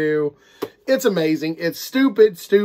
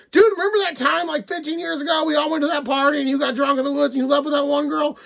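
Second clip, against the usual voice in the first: higher, lightly teasing friend voice; buddy teasing; 9 semitones higher than usual. Retold or imitated. Friend bringing up an old party story, higher teasing voice. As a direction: rib your buddy about old times. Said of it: "And you're like".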